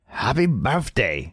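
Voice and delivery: slow, badly faked German accent on happy birthday; a mock German accent, far off the real thing; hammed-up German accent, slowly drawn out. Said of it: "I was trying to do a German accent".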